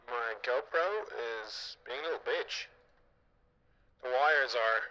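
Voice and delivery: tinny walkie-talkie filter; radio walkie-talkie voice. Real, not acted. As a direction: talk through a cheap radio. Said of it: "radio walkie-talkie voice".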